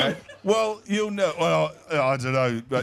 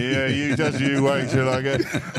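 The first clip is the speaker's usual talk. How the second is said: menacing cockerel; 6 semitones lower than usual. Retold or imitated. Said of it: "the cockerel will be looking at me in side eye going".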